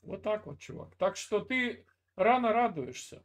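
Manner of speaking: ordinary talk, nothing marked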